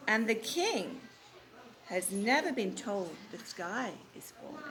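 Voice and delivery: slow, dramatic read-aloud; story narration. A narrator's reading. Teacher finishing the story for the class, slow. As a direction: savour the ending for the children.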